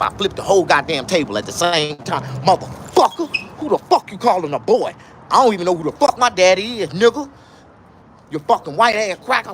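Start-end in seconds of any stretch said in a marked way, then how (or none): 2.4-9.5 going off. Retold or imitated. Storyteller's mock fury at the man who called him boy. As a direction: tear into them, daring them to repeat it. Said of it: "I started going off on that motherfucker".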